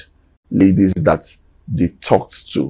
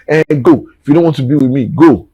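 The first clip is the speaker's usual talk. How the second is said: higher, louder imitation of an indignant mom; mouthing off; 7 semitones higher, 4 dB louder, faster than usual. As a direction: act out mom mouthing off at dad, pitched up. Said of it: "who was still making mouth".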